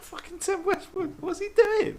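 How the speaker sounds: high, loud laugh-through disbelief; amused outrage; laughing exasperation. Real, not acted. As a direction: crack up while you say it.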